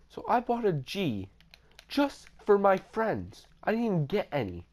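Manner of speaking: high-pitched, incredulous grievance; exasperated complaint. Real, not acted. Storyteller complaining about friends taking his weed, exasperated.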